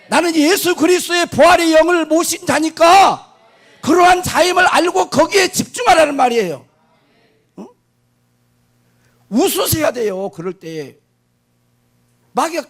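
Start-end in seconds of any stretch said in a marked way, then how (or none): 0.1-6.6 higher, louder, shouted proclamation; preaching at full force. Real, not acted. Pastor crying out to the congregation, full force preaching. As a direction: shout it to the rafters. Climax of sermon declaration.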